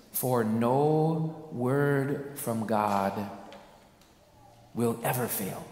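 very slow, weighty For no word; solemn emphasis. Acted. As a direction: lay each word down. Slow solemn scripture quote.

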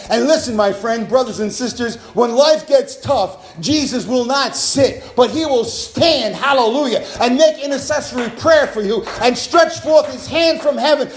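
loud, high, rousing pulpit shout; full-force preaching. Real, not acted. Preacher promising the congregation Jesus will stand for them, full-force preaching. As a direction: build to a sustained, triumphant cry.

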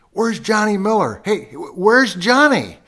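high, loud call across a crowd; yell. Retold or imitated. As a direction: shout it out like a heckler calling for a player. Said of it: "I'm gonna yell a name".

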